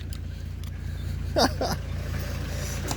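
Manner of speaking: high, airy chuckle; amused laugh. Real, not acted. Man amused by the huge aerial on his dog, high laughing. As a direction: laugh lightly, high and wheezy.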